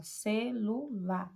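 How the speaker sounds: "Celular" slowly in three beats, ce-lu-lar; slow, divided "Celular", one pulse per syllable. Shown as a demonstration. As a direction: separate each syllable of "Celular" for learners. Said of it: "três emissões de voz".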